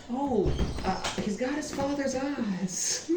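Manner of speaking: put-on high, slow baby-admiring voice; cooing admirer. Guild contact peeks at tiefling's child, cooing. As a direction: draw it out high, ending near laughter.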